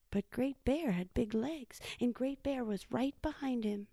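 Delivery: plain reading voice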